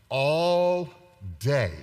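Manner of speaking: ordinary talk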